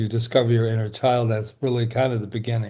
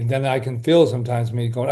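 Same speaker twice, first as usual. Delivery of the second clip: ordinary delivery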